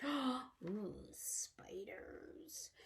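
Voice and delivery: hushed gasp then whisper; whispered awe. Real, not acted. Teacher whispering about spiders to children. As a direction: whisper it like a discovery.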